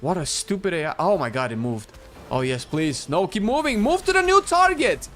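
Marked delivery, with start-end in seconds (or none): 3.1-5.1 high, loud pleading yell; shouting orders. Real, not acted. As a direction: urge the tank on, voice cracking high. Yelling at tank AI.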